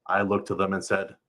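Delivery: plain reading voice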